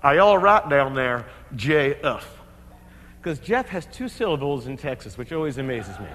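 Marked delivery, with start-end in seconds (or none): 0.0-2.3 Texan yell down. Retold or imitated. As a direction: call down the ice like a Texan buddy. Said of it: "you hear McAndrews yell down".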